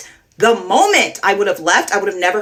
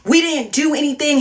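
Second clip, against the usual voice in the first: retold screaming; 7 semitones higher than usual. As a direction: throw the accusation back in a raised voice, half-shouted. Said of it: "He's screaming back at me".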